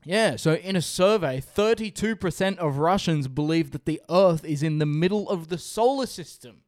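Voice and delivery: slow, showy trivia delivery; fun fact presenter. Acted. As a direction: draw out the big reveal.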